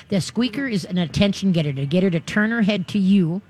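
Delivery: ordinary delivery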